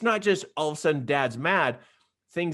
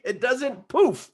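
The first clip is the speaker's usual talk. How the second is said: playful emphasis; 7 semitones higher, 4 dB louder than usual. Acted. Playful emphatic repeat to students.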